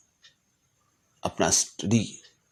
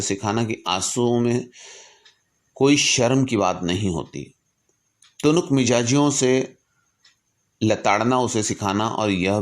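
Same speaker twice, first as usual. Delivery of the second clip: raised, earnest recitation of a letter; formal letter reading; 4 semitones higher, 4 dB louder than usual. Acted. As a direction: read it like a solemn public address. Reads Lincoln's letter aloud. (गंभीर भाव से पत्र का पाठ)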